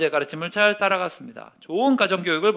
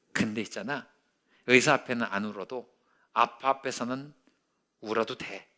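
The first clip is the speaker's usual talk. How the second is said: tender father; 5 semitones lower, 4 dB quieter than usual. Retold or imitated. Father comforting his hurting son, soft, low and tender. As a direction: hush it gently, like a father at the bedside.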